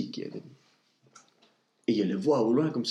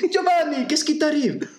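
much higher, louder cry; alarmed call; 5 dB louder than usual. Acted. Pertighetti cries out to fainted boss Giovanni, high and alarmed. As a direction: shout to the fainted man.

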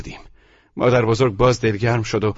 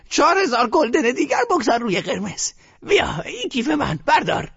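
put-on high bossy old woman; grandmother ordering. A narrator's reading. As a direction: push the bet on him.

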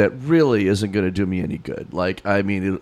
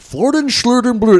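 high mock Swedish gibberish, Swedish chef style; bork-bork Swedish chef accent; terrible Swedish accent; 12 semitones higher, 6 dB louder than usual. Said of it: "I just do a terrible Swedish accent".

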